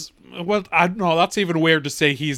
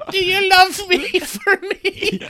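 much higher, slow, laughing plea; needy groom; 13 semitones higher, slower than usual. Retold or imitated. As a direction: whine it high and slow through laughter.

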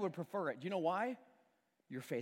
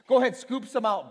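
"Go ahead, scoop some out" repeated much louder and firmer; louder insistence; 9 dB louder than usual. Retold or imitated. Jesus urging the servants on, louder the second time.

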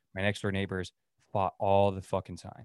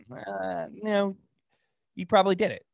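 high sarcastic verdict; 9 semitones higher than usual. Real, not acted. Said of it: "sarcastic answer".

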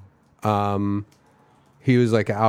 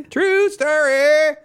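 very high, slow drawn-out catchphrase; TV catchphrase impression; 5 dB louder, much slower than usual. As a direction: stretch it like the TV intro. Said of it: "every time I hear somebody say".

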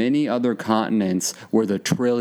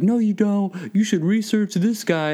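much higher, slower know-it-all voice; mocking the conspiracy guy; 11 semitones higher than usual. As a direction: squeak out a smug correction.

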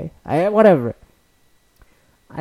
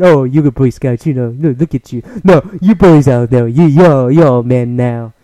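a hint of Trump, louder, hammed-up praise; lightly voiced Trump fawning over the boys; mocking Trump's speech to the Boy Scouts, loud and hammy; 5 dB louder than usual. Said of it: "he goes".

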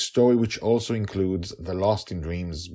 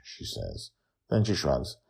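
usual speaking voice

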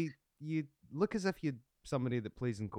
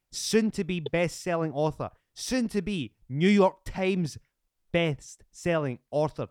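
slow, drawn-out showman introduction; announcer hype; 4 semitones higher, 6 dB louder, much slower than usual. Pretend. Host introducing violinist as a future author, slow announcer hype. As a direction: build up the guest word by word.

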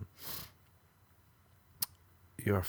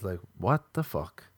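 ordinary talk

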